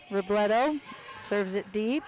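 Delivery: ordinary talk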